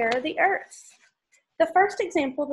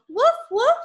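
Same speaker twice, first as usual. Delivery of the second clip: high yappy puppy woof; puppy bark; 10 semitones higher, 7 dB louder than usual. Acted. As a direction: bark like a small happy dog. Dog barks in the story.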